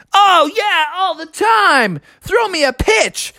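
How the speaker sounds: put-on high, cocky eager kid; Froggy. Boy asking for a pitch, eager. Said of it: "And Froggy's like".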